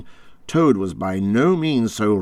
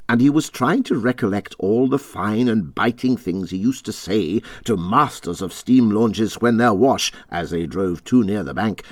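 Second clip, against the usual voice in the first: ordinary delivery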